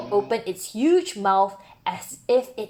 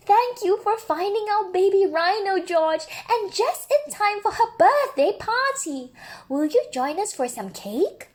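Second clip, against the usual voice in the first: higher, happy thank-you; higher, glad zookeeper voice; grateful zookeeper; 10 semitones higher than usual. Acted. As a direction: thank George warmly as the zookeeper.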